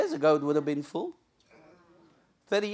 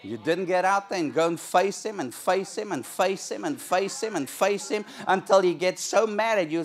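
usual speaking voice

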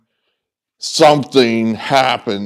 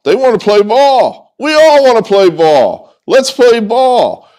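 loud, high-pitched fired-up shout; rousing rallying cry; 10 semitones higher, 5 dB louder than usual. Real, not acted. Host rallying players and fans to play, fired up. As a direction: belt it out like a fan chant.

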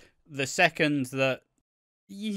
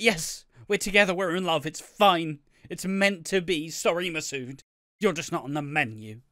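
higher, louder Sharon-style declaration; Sharon impression; 4 semitones higher, 3 dB louder than usual. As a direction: deliver it like a soap matriarch. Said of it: "I delivered that like Sharon".

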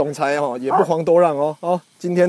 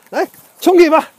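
calling out; 11 semitones higher, 7 dB louder than usual. Real, not acted. Sending the animal after ball.

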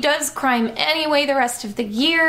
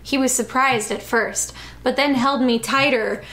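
ordinary talk

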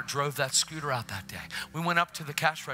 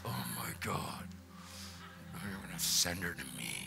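hammed-up deep voice of an ominously displeased wife; mock menacing wife; 8 semitones lower, 10 dB quieter than usual. Wife's ominous reaction, jokingly exaggerated.

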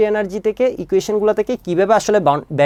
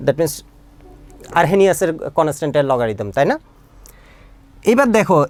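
ordinary talk, nothing marked

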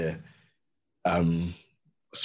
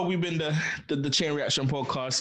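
ordinary talk

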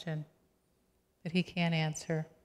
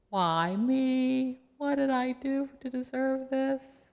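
high, slow anguished plea; plaintive wail asking why; Job's plea; 8 semitones higher, much slower than usual. Acted. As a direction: cry out to God like a broken man. Job's plea voiced by preacher.

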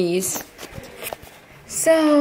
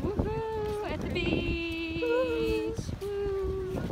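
excited cheering; 9 semitones higher, 9 dB quieter, much slower than usual. Real, not acted. Arriving at the beach.

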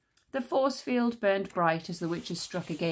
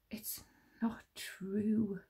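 slow, whispered denial; horrified whisper; 3 semitones higher, 7 dB quieter, much slower than usual. A narrator's reading. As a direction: whisper it in disbelief.

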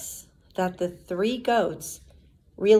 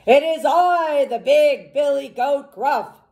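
louder, higher proud announcement; booming billy goat, louder and higher; 5 semitones higher, 5 dB louder than usual. Acted. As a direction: call out big and proud. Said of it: "his voice was as loud as the troll's".

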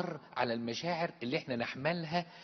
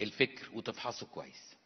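ordinary talk, nothing marked

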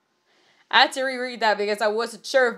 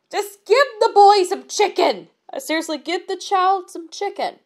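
exasperated plea; 6 semitones higher than usual. Real, not acted. Reader's own exasperated demand.

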